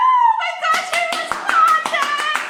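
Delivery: very high, loud ecstatic squeal; overjoyed scream. Retold or imitated. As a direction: shriek it, drawing out the last word. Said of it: "I was so happy when I saw".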